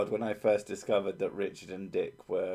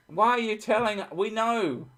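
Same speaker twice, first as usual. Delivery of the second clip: high, loud exasperated outburst; 11 semitones higher, 3 dB louder than usual. Real, not acted. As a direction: throw up your hands in exasperation.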